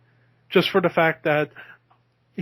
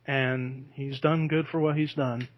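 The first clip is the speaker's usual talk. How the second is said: normal talking voice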